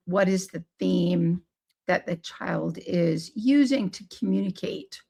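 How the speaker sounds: usual speaking voice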